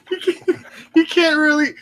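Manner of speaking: high-pitched words through laughter; laughing too hard. Real, not acted. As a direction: struggle to speak while cracking up. Still laughing at friend's one voice.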